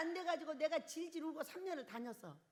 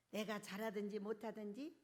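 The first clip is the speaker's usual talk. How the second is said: ordinary talk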